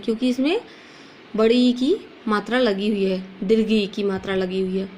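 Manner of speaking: usual speaking voice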